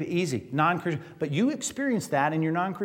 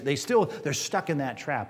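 ordinary talk, nothing marked

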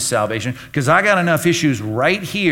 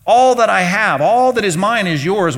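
father proclaiming; 5 semitones higher, 5 dB louder than usual. Retold or imitated. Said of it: "the father says to the older brother".

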